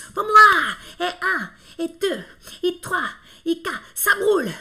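mock French gym coach counting reps; gym instructor. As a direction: shout the count like a fitness coach. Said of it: "professores de academia na fr- franceses, né, falando". (professor de academia francês contando)

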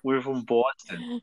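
slow, put-on Boston accent. Host mimicking Boston locals introducing themselves, Boston accent. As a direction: draw it out like a mock Bostonian. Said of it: "I love Boston accents".

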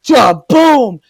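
loud two-beat impact noise; punchy slam sound effect; imitated slam. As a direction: boom it out like a body hitting the mat. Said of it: "he just goes".

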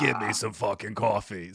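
a hint of a chain-smoking dad barking an order; host playfully imitates the guest's dad in a mild smoker voice; dad impression. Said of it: "That's actually, like, a perfect impression".

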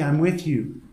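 ordinary delivery

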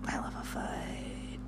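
unvoiced whisper, slow. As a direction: breathe it out as a secret. Whispered repeat of her line.

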